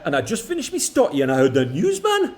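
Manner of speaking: put-on a light, amateur Geordie accent; a son asks his dad for a Geordie accent, mock Geordie lad. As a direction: put on a hammed-up Geordie lad's voice. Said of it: "that accent went really bad at the end".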